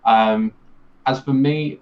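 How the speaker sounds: ordinary delivery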